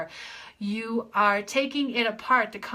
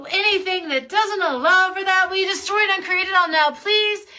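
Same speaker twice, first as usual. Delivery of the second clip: high, loud and quick; high playful voice; 8 semitones higher, 7 dB louder than usual. Acted. Coach clearing beliefs in a high loud playful voice. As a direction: pitch it up high and push it out.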